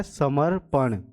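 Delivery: normal talking voice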